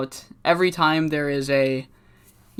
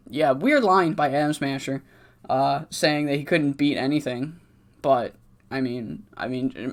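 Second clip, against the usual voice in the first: usual speaking voice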